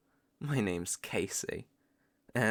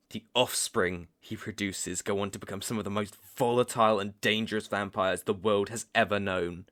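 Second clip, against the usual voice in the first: ordinary talk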